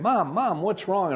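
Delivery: high, urgent calls for his mother; panicked yelling. Retold or imitated. Son runs through the house calling out in a panic for his crying mother. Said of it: "ran through the farmhouse yelling".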